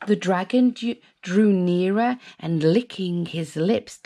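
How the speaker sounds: ordinary delivery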